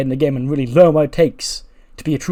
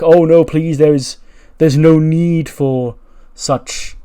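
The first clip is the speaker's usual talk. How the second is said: raised, drawn-out mock plea; pleading peacemaker; 4 semitones higher, 8 dB louder, much slower than usual. [name] calming hostility in the classroom, loudly pleading. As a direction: plead loudly and higher, stretching the last words.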